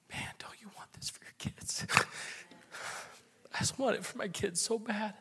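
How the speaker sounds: a real, broken whisper through tears; tearful whisper. Real, not acted. Pastor pleading with parents in the church, whispering through tears. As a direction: whisper it, barely able to get the words out. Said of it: "I'm so sorry I got so, uh, emotional".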